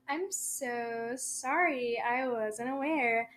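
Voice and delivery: slow, quiet, nervous apology; mock timid apology. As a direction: tiptoe through it like she might bite. Timid apology to scary girlfriend.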